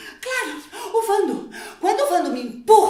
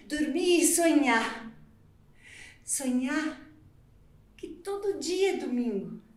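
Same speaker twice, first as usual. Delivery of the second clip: drowsy and soft; 5 semitones lower, 9 dB quieter than usual. Acted. Drowsy soft Sunday reverie.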